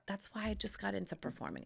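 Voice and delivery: whisper. Real, not acted. Whispered punchline.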